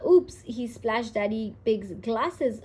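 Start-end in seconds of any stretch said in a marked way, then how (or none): 0.1-0.4 loud sudden oops, much higher; startled yelp; surprised oops. A narrator's reading. Splash in puddle.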